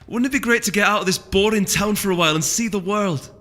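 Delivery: earnest eager plea. A narrator's reading. Cranberry insisting to Rai, eager and earnest. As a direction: insist she means every word.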